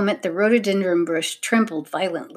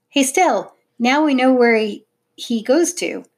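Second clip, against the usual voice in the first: calling to sister; 4 semitones higher, 4 dB louder than usual. A narrator's reading. Tom calls to his sister.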